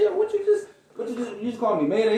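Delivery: startled, high-pitched and loud in disbelief; surprised. Retold or imitated. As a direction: caught off guard, voice jumping way up. Hears friend name him Mayday.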